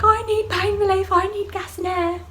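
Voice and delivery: high, loud drawn-up wail; desperate demand. Retold or imitated. Labouring woman to panicking staff, loud high desperate demand. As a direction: act out begging at full voice.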